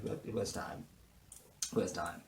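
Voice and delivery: breathy aside; whispered joke to the viewers. Real, not acted. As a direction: toss it off under your breath. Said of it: "whispered aside".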